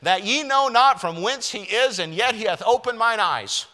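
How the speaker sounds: high, loud sarcastic retort. Acted. Healed man taunting the Pharisees, loud sarcasm. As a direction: rub it in with mock amazement. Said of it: "A touch of sarcasm here".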